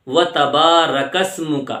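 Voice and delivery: و تبارک اسمک drawn out; slow correct recitation. Shown as a demonstration. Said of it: "آگے لفظ ہے".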